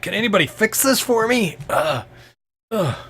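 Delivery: high, whining plea from an injured man; pained, pleading game character; wounded, needy Walrus. Acted. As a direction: complain through the pain, pitched high.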